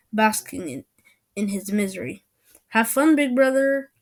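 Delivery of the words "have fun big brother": high, loud sing-out taunt; playful jeer; teasing. A narrator's reading. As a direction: gloat at a big brother. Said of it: "I teased".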